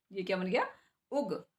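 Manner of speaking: usual speaking voice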